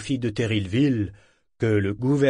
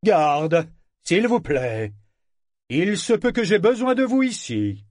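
raised voice; 8 semitones higher than usual. A narrator's reading. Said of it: "il haussa le ton".

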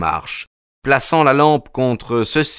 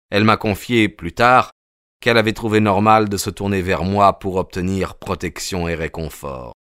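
ordinary delivery

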